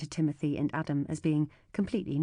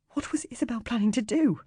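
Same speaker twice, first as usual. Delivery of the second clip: stammering; 8 semitones higher, 4 dB louder than usual. A narrator's reading. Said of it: "I stammer".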